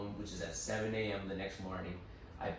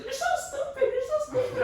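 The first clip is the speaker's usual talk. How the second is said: shrill high taunting voice; mocking parents; 8 dB louder than usual. Narrator mocking parents' nagging in a shrill squeak. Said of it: "every time it's just like".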